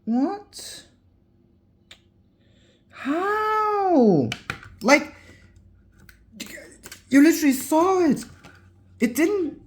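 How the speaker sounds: high, amazed squealing voice; shocked and thrilled; stunned disbelief. Real, not acted. Disbelief that screen survived.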